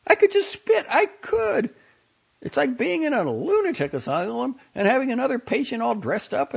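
put-on high, fretful young woman complaining; a high-pitched, exasperated young woman; Franny's voice. A narrator's reading. Franny's worried voice, read aloud.

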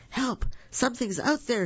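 high, scared cry for help; frightened cry. A narrator's reading. Little monster hears footsteps, frightened high cry. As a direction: squeak it out in fright.

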